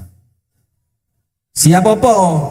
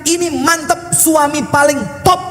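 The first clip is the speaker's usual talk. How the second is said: wife's voice; 9 semitones higher than usual. Acted. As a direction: praise him lightly and sweetly.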